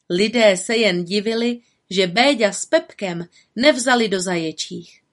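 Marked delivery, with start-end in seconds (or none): none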